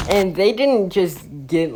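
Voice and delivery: plain reading voice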